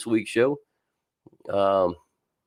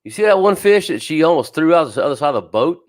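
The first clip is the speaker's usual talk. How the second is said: ordinary talk, nothing marked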